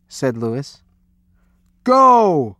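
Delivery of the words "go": loud, high, long-held shout; coach's roar. A narrator's reading. Coach sending swimmers off, loud roar. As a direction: roar the start signal, stretching it out. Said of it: "roared the coach".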